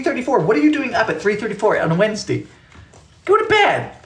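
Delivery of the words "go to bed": mock scold. Scolding a viewer up late.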